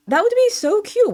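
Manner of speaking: high, gushing squeal; giddy high-pitched swoon; squealing so cute. Real, not acted. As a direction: gush over the idea of free money. Repeats the daydream.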